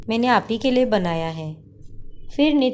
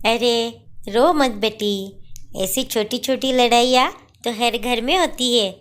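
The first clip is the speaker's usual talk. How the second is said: mother-in-law; 2 semitones higher than usual. Acted. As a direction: comfort a crying daughter-in-law. (रोती बहू को समझाती सास की आवाज़)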